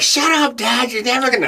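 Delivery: high, childish mock whine; a lightly voiced bratty kid; mock daughter. Daughter telling dad to quit, high childish whine. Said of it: "even my daughter's like".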